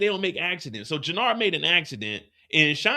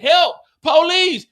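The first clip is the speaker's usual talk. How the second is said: high, loud, slowed yell for police; mock cry for help; 11 semitones higher, 9 dB louder, slower than usual. Pinned against the wall, he yells for police. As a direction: wail for help like a cornered man. Cornered man calls for help.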